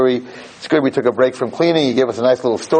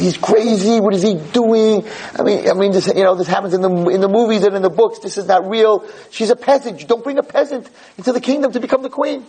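shocked gossip; 8 semitones higher than usual. Acted. Palace staff gossiping in shock.

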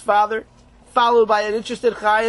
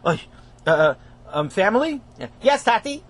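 ordinary delivery, nothing marked

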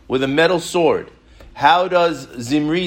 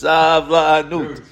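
"Savlanut" stretched to twice the usual length; drawn-out key word; 3 dB louder, much slower than usual. Real, not acted. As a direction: teach "Savlanut" slowly, syllable by syllable. Repeats key word to teach it.

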